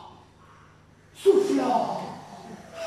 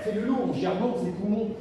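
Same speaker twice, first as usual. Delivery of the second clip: ordinary delivery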